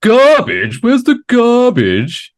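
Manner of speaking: high, slow puzzled echo of garbage; a British friend mocking the American word garbage, drawn out high; baffled friend. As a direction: repeat garbage back as if it's a foreign word. And they're like.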